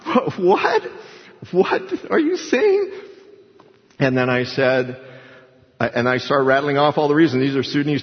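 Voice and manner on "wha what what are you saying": much higher, imitating a stunned listener; shocked chaplain. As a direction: replay the chaplain's disbelief, pitched way up. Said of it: "he was shocked".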